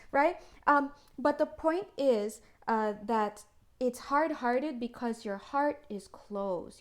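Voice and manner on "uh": ordinary delivery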